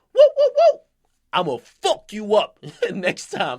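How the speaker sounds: whoa yelled high and loud, then a laugh. Retold or imitated. [name]'s crew stepping up to him, loud high mock yell. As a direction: bark it like a bully, then laugh. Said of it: "the biggest motherfucker in the game goes".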